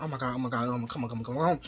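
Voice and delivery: low, hushed frantic muttering; retold panic. Muttering to himself while filling the cup, retold hushed panic. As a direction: mutter a retold panic under your breath. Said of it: "I'm just panicking".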